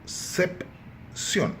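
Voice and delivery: "Concepción" slowly split as con, cep, ción; syllable by syllable. Shown as a demonstration. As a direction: pause after each syllable of "Concepción". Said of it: "dividir a palavra em sílabas".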